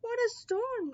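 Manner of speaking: high twittering bird voice; twittering wren. Acted. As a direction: chirp it out like a little bird. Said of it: "twitters wren".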